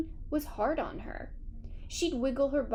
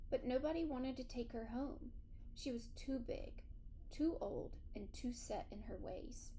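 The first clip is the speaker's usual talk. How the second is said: ordinary delivery, nothing marked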